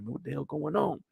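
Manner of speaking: hushed unvoiced whisper. Sneaking closer to watch, whispering. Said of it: "I'm like".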